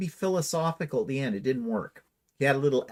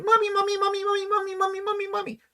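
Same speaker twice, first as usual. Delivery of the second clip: put-on much higher, whining child calling mummy; whiny little child; much faster than usual. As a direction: whine like a small kid tugging a skirt. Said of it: "like a five-year-old tugging at his mother's skirt".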